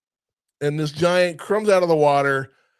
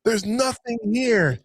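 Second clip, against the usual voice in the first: high, slow imitated protest; mock exasperation; 8 semitones higher, slower than usual. Wally to Randall in empty desert, drawn-out mock exasperation. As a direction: stretch it out high in disbelief.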